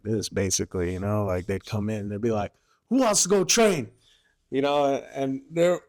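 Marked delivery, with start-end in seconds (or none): 2.9-3.9 high, loud call to the room. Retold or imitated. Lance corporal calling for volunteers to train, loud call. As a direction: shout it out to the squad bay.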